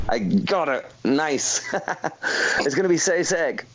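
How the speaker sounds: ordinary talk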